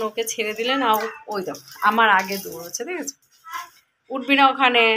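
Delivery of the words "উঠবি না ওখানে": sharp, louder warning to the pet; scolding the dog to stay off, louder. Real, not acted. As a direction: tell the dog off firmly.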